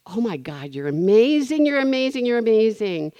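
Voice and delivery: shower her with delight; gushing praise. Retold or imitated. Thanking the teller who fixed the fee, gushing.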